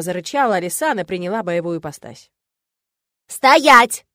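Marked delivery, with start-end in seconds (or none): 3.3-4.0 loud high shout to halt; yelled command. A narrator's reading. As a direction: bellow it to stop them. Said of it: "завопила я". (громкий крик «Стоять!»)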